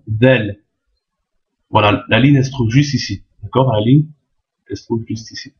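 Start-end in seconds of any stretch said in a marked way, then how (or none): 0.1-0.6 "Del" begun like English TH; tongue tip between the front teeth on "Del"; interdental consonant. Shown as a demonstration. Teacher showing tongue placement, interdental TH at the start of "Del". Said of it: "Le bout de la langue entre les dents de devant".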